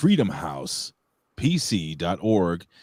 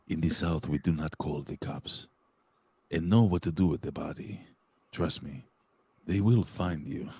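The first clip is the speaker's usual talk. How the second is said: slow, softer mock Spanish accent; 3 semitones lower, 5 dB quieter than usual. Host rereading the comment on request, slow Spanish accent. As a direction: read it slowly with a put-on Spanish accent. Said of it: "do it in a Spanish accent".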